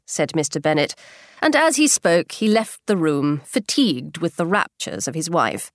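ordinary delivery

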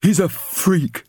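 high, slow, sneering; cruel bully. Acted. Bully boy mocking the old man, cruel sneer. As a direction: spit it out cruelly. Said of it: "Ginger continued cruelly".

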